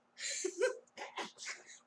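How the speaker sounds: high, breathy forced giggle; cringe giggle. Acted. Momo trying to hold back laughter, a forced high giggle. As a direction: squeeze out a fake girlish giggle. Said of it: "I know that laugh is super cringe".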